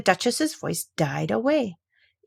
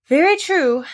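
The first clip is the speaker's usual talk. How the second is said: high, loud, booming agreement; hearty Duchess; 10 semitones higher, 7 dB louder, slower than usual. Acted. Duchess to Alice, loud high agreement. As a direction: boom it out grandly.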